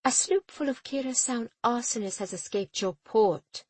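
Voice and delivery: firm, restrained, deliberate; captain forcing herself to stay civil; controlled tone. A narrator's reading. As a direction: hold the anger in check. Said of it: "She controlled her tone with effort".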